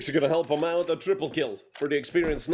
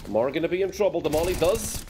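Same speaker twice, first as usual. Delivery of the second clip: excited call; 6 semitones higher than usual. Real, not acted. Caster hypes a clutch molly kill.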